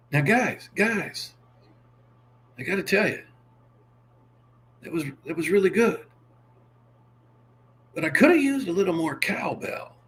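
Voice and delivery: a hint of Christopher Walken; Christopher Walken impression. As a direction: deliver the cowbell line with strange pauses. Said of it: "I can do a Christopher Walken".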